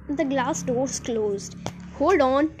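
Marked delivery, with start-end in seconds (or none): 2.0-2.5 loud, higher-pitched call; cried warning. A narrator's reading. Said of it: "cried Mister Wonka".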